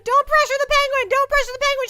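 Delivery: shrill mock pleading; pleading penguin. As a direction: beg like a frightened little penguin. Begging chat not to pressure her.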